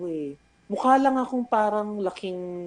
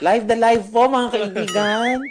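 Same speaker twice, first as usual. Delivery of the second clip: mock vlogger sign-off; 3 semitones higher, 7 dB louder than usual. Mock broadcast sign-off to listeners.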